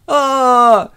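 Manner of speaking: high, loud drawn-out "aah"; hammed-up scream of the drowning; mock scream. Storyteller voices tsunami victims, high mock scream. Horses swept away by tsunami.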